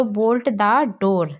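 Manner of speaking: very slow, careful English phrase; drilled model sentence; slow teaching model. Shown as a demonstration. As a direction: lay each word out for learners. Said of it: "तो कहते हैं कुंडी लगा दो".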